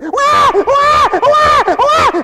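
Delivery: high, loud imitation baby cry; baby wailing. Preacher imitating babies in their crib, mock wailing. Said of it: "Babies do that. They're in their crib".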